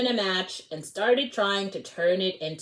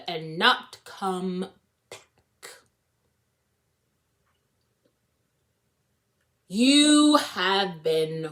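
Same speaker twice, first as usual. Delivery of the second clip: stern warning; 4 dB louder, slower than usual. Acted. Strict teacher warning the class.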